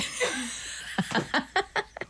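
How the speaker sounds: long, helpless laugh; real laughter. Real, not acted. DJ cracking up at a listener's chicken-foot socks, laughing. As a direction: burst out laughing, mostly breath.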